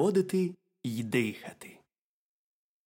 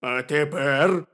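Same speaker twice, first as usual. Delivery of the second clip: slow, loud little bear; hearty, slow-talking bear; 3 semitones higher, 8 dB louder, much slower than usual. A narrator's reading. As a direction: draw it out as an unhurried bear. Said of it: "перепитав ведмедик".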